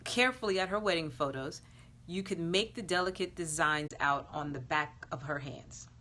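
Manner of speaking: ordinary delivery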